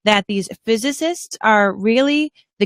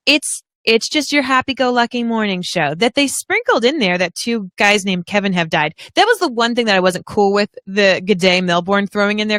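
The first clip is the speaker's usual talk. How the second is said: ordinary delivery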